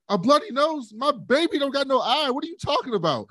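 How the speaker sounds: high, incredulous mother voice; high put-on voice of an appalled queen; mock outraged mother. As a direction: play the furious mom who can't believe it.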